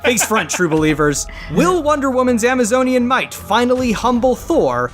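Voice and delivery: ordinary talk, nothing marked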